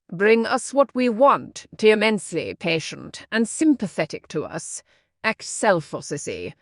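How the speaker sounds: ordinary talk, nothing marked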